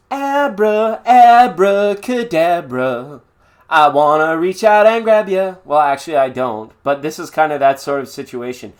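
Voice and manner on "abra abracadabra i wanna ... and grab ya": loud, high, drawn-out spell-casting voice; hammy magician. Acted. Performing magic for a viewer, hammy loud incantation. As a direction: cast the spell like a showman.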